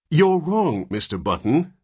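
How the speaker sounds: high, loud stern correction; severe. Acted. Nurse to the father, severe and stern. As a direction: set him straight sternly. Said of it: "said the nurse severely".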